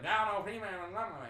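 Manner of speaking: mock West Virginian accent. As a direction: run it together in garbled West Virginian. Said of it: "can't understand West Virginian accent".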